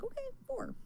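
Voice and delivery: brightly mock the bottle's instruction; mock cheerful. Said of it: "So I'm like".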